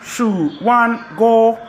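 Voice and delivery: SHU WAN GO high, loud and slow; pitched Chinese syllables. Shown as a demonstration. Repeating after the teacher, slow high SHU WAN GO. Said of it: "Chinese people speak in pitches".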